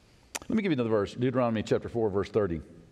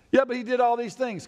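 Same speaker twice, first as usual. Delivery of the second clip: indignant objector; 9 semitones higher than usual. Pretend. Preacher voicing a doubter, higher and protesting.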